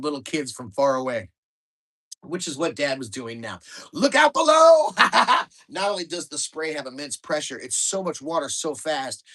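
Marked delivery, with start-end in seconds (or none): 3.9-4.9 loud high yell from the roof; shouted warning. Acted. Dad yelling from roof. 5.0-5.4 high loud cackle; gleeful laugh. Acted. Dad laughing on roof.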